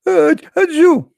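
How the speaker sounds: high, loud hammed-up sneeze; loud fake sneeze. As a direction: sneeze big and theatrical.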